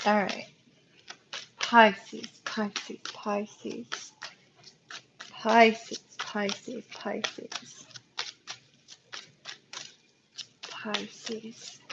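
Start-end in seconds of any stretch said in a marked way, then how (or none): 1.6-11.8 hushed, almost to yourself; murmured repetition. Real, not acted. Card reader calling up Pisces over the cards, murmured very softly. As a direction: murmur it very softly, fading with each repeat.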